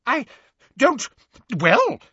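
high, halting bluster; stammering official at a loss. A narrator's reading. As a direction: bluster and break off, flustered. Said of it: "blustered Fudge".

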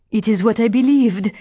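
high, loud, vehement outburst; impassioned cry. A narrator's reading. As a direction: cry it out with conviction. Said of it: "cried Adele".

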